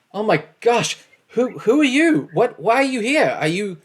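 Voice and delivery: high, loud panicked questions; frightened villager. Acted. Villagers panicking at the armed heroes, high and loud. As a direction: plead with the strangers in alarm.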